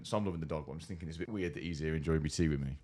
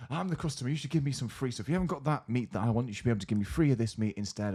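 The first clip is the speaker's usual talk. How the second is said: customer getting louder; 6 semitones higher, 3 dB louder than usual. Retold or imitated. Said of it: "he starts getting louder and louder".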